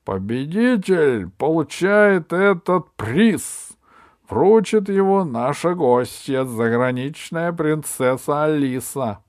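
high, slow, loud royal speech; booming warrior king. Acted. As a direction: proclaim it grandly to the crowd. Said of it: "Король поднял кубок в руке и произнёс".

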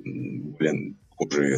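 ordinary talk, nothing marked

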